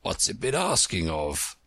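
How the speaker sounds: put-on a touch of hoarse old frog. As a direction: croak it out from the throat. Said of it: "He was so hoarse".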